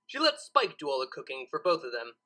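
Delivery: plain reading voice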